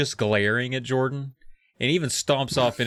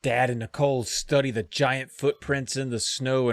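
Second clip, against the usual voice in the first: ordinary talk, nothing marked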